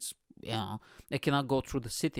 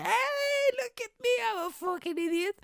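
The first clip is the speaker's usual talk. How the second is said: high mock woman voice. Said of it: "she leaned over and be like".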